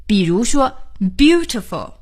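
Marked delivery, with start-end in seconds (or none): 1.2-1.9 "beautiful" as BEAU-ti-ful, first syllable higher; BEAU-ti-ful, teacher's model; stress on first syllable. Shown as a demonstration. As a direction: punch the opening of "beautiful", let the rest fall. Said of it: "重音呢就是在第一音节上".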